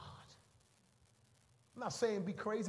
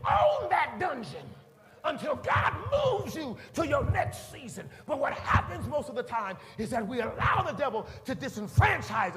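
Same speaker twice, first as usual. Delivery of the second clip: loud, higher shouted preaching; preaching at full force; 4 semitones higher, 10 dB louder than usual. Acted. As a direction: drive it home from the pulpit. Preaching climax.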